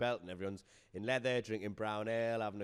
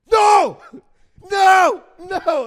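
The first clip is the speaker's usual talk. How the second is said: very loud, high-pitched acted outburst; imitated panicked shouting; 24 dB louder, much slower than usual. Audience member answering the MC's small talk, mock traumatized shouting. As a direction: shout each one separately, hammed-up alarm.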